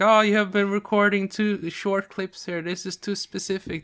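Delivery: imitated scolding in a high voice; mock scolding. Retelling partner's complaints about the video clips, high-pitched mock scolding. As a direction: pitch it up and reel off complaints, mimicking a critic. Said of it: "Scolded me for".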